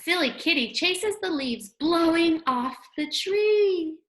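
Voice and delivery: ordinary talk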